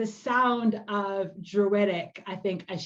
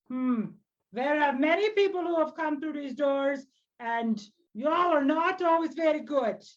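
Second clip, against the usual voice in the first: high, deliberate elder voice; wary old druid; 5 semitones higher than usual. Acted. Old druid guards her precious plants from a stranger, wary. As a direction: size up the stranger and demand an account.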